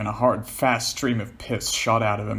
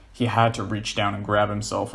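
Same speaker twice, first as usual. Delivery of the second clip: plain reading voice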